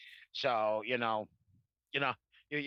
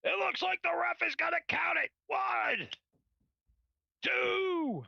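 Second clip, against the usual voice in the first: much higher, frantic play-by-play call; frantic mock sports commentary; 11 semitones higher, slower than usual. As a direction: shout it like a ringside announcer counting. Host mock-calls his phone struggle.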